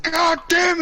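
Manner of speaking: high exclaimed gamer outburst; mock rage. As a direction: blurt it out in imitated frustration. Imitates friend raging when he lags.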